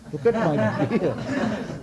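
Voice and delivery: slow, chuckling through the words; old sage laughs off being called a child; laughing protest. Retold or imitated. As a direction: laugh at the insult as you say it. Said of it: "Markandeya could not contain".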